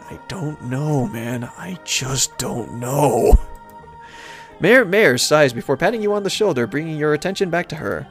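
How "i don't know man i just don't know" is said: slow, low, drawn-out bafflement; baffled. Acted. Baffled pony answering himself, bewildered. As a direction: stretch each word out, lower.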